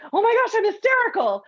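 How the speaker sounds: high, fast, panicky Leo Bloom; mock hysterical panic. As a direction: squeal it like a nervous wreck. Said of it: "Leo Bloom gets very nervous".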